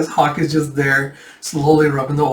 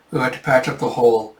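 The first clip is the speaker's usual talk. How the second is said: plain reading voice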